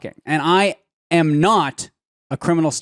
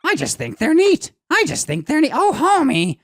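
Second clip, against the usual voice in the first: much higher Marge Simpson voice; 10 semitones higher, slower than usual. Retold or imitated. Joking mashup of Marge as Gollum for friends, high. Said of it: "I'll do Marge Simpson as, as Gollum".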